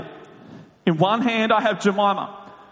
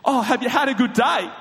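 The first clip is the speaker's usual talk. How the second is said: higher, chirped question from the kitchen; piping up; 6 semitones higher than usual. Retold or imitated. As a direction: pipe up with a friendly question. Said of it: "Kayla would pipe up from the kitchen".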